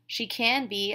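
ordinary delivery

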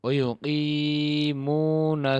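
ওয়া ইউকিমুনা drawn out slowly and evenly; slow Arabic recitation. Shown as a demonstration. Teacher modelling Quranic reading slowly. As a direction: stretch the final vowels of ইউকিমুনা. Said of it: "আলিফ আর লাম বাদ".

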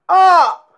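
a sharp high shriek from the possessed man; a preacher voicing a demon's scream; demon's loud cry. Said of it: "s'écria d'une voix forte".

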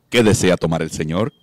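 booming polite waiter; two-metre angel barman asking for his order, deep. Acted. Giant angel waiter appears.

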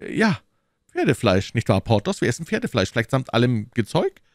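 animated teasing musketeer; needling musketeer. Acted. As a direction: press the host with the truth. Reader voices the needling friend.

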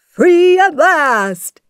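high, loud, drawn-out exclamation; joyful cry. A narrator's reading. Genie is freed by Aladdin, crying out joyfully. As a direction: cry out in elation, stretching it. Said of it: "the genie cried".

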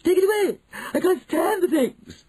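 very high, loud hysterical shriek; piercing panic at a mouse; panicked yelling. A narrator's reading. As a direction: yell in squealing terror.

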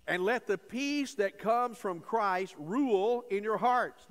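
preacher lingering over each word of the verse; slow scripture emphasis. Shown as a demonstration. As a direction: read the verse slowly, leaning on "let". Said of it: "Now notice that phrase there".

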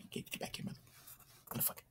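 hissed under the breath at the enemy; muttering a curse at the player he chases; whispered curse. Real, not acted. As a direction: whisper the cuss as you chase.

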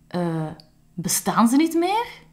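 high, loud, startled gasp of a question; shocked question. Acted. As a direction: sound truly taken aback as Lies. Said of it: "Lies was vreselijk hard geschrokken". (Lies, geschrokken, vraagt hoog en luid)

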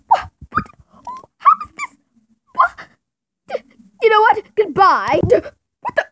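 very high, frantic squealing; panicked squeak. Acted. Panicking over missing fidgets.

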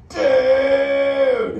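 stretched-out loud "Dude!"; long shouted dude. Shown as a demonstration. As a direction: yell "Dude!" long and big for the crowd. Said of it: "like this".

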